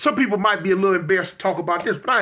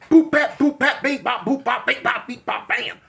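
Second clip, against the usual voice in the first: fight sound effects; 4 semitones higher than usual. Retold or imitated.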